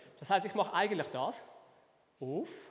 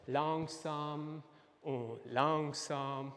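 very slow, lower, stretched syllables; drawn-out slow; 4 semitones lower, much slower than usual. Shown as a demonstration. Teacher showing what lento means, very slow. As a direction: stretch LANGSAM out as slow as the move. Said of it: "Lento heisst langsam".

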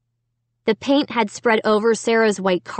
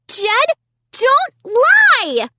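high, loud accusing scream; 5 dB louder, much slower than usual. A narrator's reading. Sister accusing little brother, screaming. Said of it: "Sarah screamed".

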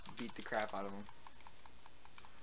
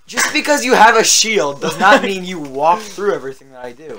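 louder, higher exasperated rant; 3 semitones higher, 11 dB louder than usual. Real, not acted. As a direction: vent at the unfair enemy. Complaining at invulnerable enemy.

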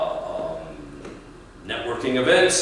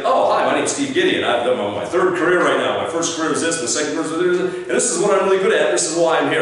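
loud, higher, rattled-off rehearsed self-introduction; push-button pitch; 6 semitones higher, 4 dB louder than usual. Acted. Job seeker triggered into pitching, automatic and eager. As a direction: reel it off eagerly without thinking. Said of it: "it's like a button you should push".

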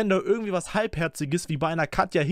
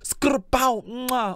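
high spelt-out rap noises; imitated rap adlibs; 6 semitones higher than usual. Reactor shows chat his adlib imitations, high. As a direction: throw out mock adlib noises, pitched up. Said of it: "ich mach gerne Adlibs nach".

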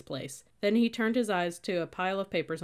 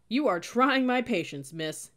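exasperated anger; 6 semitones higher, 6 dB louder than usual. A narrator's reading. Sergeant fed up with the cadet's pen, angry warning. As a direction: hit 'trying' hard, patience running out.

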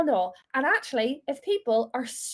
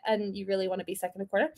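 quick quiet aside; 6 semitones lower, 7 dB quieter, much faster than usual. Real, not acted. Aside after fake congratulation.

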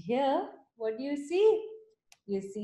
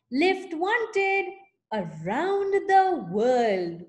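slow, higher, proclaimed; announcing the sign; 6 semitones higher, 5 dB louder, slower than usual. Acted. Reading the trail the snail wrote, slow proclamation. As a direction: read it like a banner.

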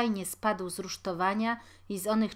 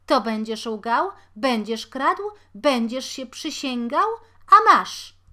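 louder and higher, peaking on the last blow; shouting; 5 semitones higher, 10 dB louder than usual. A narrator's reading. Mother scolding her son as she beats him: loud shout. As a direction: yell the accusations, scream the end. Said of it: "krzyczała kobieta".

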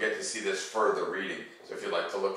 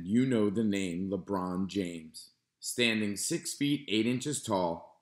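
put-on calm, even read-aloud voice for kids; reading to children. Teacher reading a nonfiction book to students, calm and clear. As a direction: read the page clearly to the class.

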